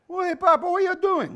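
high, loud mock Italian son; Italian son from commercial. Preacher restarting the commercial skit, high Italian son. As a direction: greet Papa in a hammed-up high voice. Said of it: "this Italian guy goes home".

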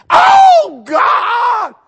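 high, loud, stretched shriek; mimicked amazed scream. Preacher mocks homeowners' only expression, hammed-up scream. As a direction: shriek it long in mock amazement.

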